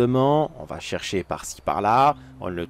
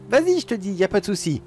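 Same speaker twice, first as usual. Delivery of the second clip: insistent urging; 8 semitones higher than usual. Acted. Insisting child climb the stairs.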